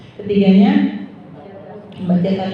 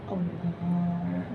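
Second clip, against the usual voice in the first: whispered-soft takbir, just for own ears; barely audible; 3 semitones lower, 12 dB quieter, slower than usual. Shown as a demonstration. Teacher demonstrates a quiet takbir to the mothers. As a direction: say it so only you hear it. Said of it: "Begitu ya kedengaran ya?".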